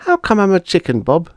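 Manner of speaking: put-on a bewildered little girl turned chicken. Bonnie as chicken asking Bob, high puzzled voice.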